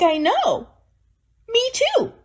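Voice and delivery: very high squeaky put-on voice; mock agreement. Agreeing with the angry aunt in a high squeaky voice. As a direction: chirp it up high, mocking. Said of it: "And I'm like".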